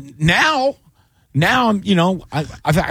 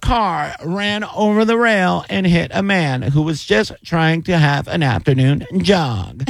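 higher, formal field-report voice; cliche news reporter; 6 semitones higher, slower than usual. Shown as a demonstration. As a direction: report live like a cheesy local newscaster. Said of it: "the cliche voice".

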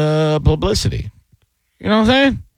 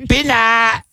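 much higher, exaggerated Vietnamese accent; 11 semitones higher than usual. Shown as a demonstration. Host repeating the name to make his point, Vietnamese accent. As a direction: pitch Bitna way up in a mock Vietnamese accent. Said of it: "when you say foreign words in their accent".

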